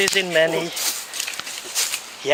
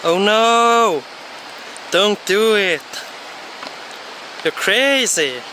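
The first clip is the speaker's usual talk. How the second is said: alarmed shout; 5 semitones higher than usual. Real, not acted. Friend near the edge, alarmed shout. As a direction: yell at him to stop.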